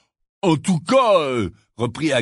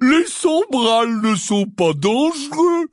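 exclaiming; 9 semitones higher, slower than usual. A narrator's reading. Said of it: "s'exclama-t-il".

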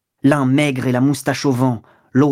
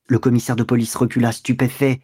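usual speaking voice

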